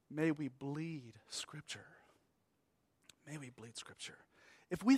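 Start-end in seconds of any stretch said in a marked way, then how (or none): 0.1-1.8 slow, soft, hushed prayer-like plea. Real, not acted. Preacher pleads with congregation to live by Scripture, slow and hushed. Solemn plea after the song. 3.3-4.2 very soft, low repeated plea; hushed repeat. Real, not acted. Preacher repeats the plea to himself and congregation, low and hushed.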